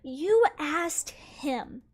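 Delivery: drawn out slowly, each word laid down; deliberate, slowed-down rebuttal in the argument; slow, pointed. Real, not acted. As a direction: spell it out slowly to mom. Arguing with mom at dinner.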